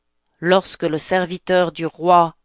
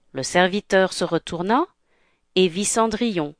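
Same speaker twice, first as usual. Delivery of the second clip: ordinary delivery, nothing marked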